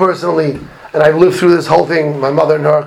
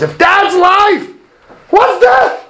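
very high, incredulous retold cry; exclaimed challenge; 4 dB louder, much slower than usual. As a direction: throw the question out high, imitating the rabbi. Acts out rabbi's challenge to students.

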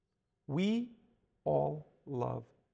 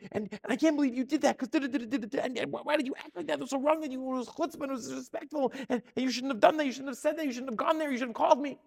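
high-pitched, piling-up blame; mock angry tirade; 7 semitones higher, faster than usual. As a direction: act out a self-justifying rant, higher and insistent. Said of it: "I'm sorry for getting angry".

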